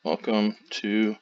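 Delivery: very slow, drawn-out words with long pauses; slow dictation. Shown as a demonstration. Typing the computer voice's script aloud, slow dictation. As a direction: spell it out slowly as if typing along. Dictating text typed for speech editor.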